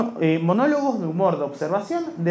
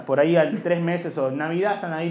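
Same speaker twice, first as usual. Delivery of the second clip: usual speaking voice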